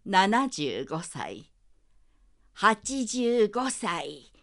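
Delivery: put-on child voice aging to very old, slowing; from little child up to shaky elder; aging voice demo. Shown as a demonstration. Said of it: "はい、やらせていただきなさい。".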